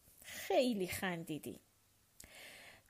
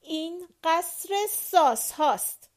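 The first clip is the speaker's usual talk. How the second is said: louder, higher, cross insistence; testy louder repeat; irritably repeated; 7 semitones higher, 7 dB louder than usual. A narrator's reading. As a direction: stubbornly repeat the complaint. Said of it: "با خلق تنگ تکرار کرد".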